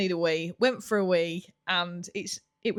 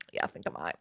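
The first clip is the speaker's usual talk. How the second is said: much lower, whispered guilty admission; sheepish whisper; 9 dB quieter, faster than usual. Retold or imitated. Conceding to her partner she'll tell her mum, sheepish whisper. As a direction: admit it under your breath.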